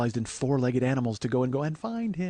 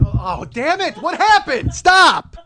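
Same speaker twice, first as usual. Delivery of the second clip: rising, much higher and louder shout; mock panicked yell; 13 semitones higher, 8 dB louder, much slower than usual. Host voicing a worried listener, mock panicked yell. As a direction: yell in alarm, building to Stop. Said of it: "if you're sitting there going".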